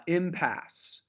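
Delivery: impasse with the stress on IM; stress on first syllable. Shown as a demonstration. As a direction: trochaic impasse, weight on im. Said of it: "the emphasis is trochaic".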